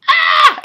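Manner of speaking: very high, loud fangirl scream; acted scream. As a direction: scream as if pushed over the edge. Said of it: "and then you're like".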